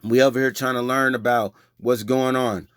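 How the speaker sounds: ordinary talk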